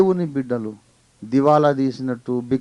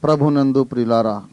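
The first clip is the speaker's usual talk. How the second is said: ordinary talk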